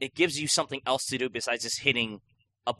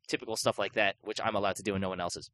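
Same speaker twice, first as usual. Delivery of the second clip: usual speaking voice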